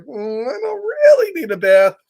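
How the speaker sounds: coy denial with a knowing hum; sly pigeon voice. Acted. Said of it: "look at his eyes, like".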